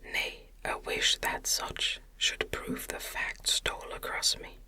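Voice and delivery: ordinary delivery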